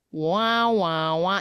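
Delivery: wailing siren noise, falling in pitch; imitated siren. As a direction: mimic a distant siren's wail. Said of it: "we're hearing sirens in the distance".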